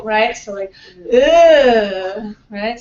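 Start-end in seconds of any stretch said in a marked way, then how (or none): 1.1-2.3 loud, disgusted ugh. Shown as a demonstration. Teacher showing the long schwa as disgust, louder ugh. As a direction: recoil from something horrible: ugh. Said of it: "something horrible".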